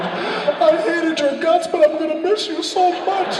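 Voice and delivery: high, loud, drawn-out mock sobbing; hammed-up tearful farewell wail. Actor recalling sobbing goodbyes to castmates, high loud mock wail. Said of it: "I'd be like".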